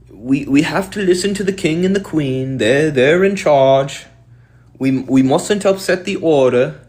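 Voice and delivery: put-on high, meek British peasant accent; mock British. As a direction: play a lowly, deferential British farmer. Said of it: "the people have this attitude".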